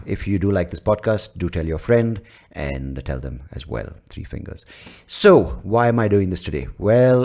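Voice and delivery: usual speaking voice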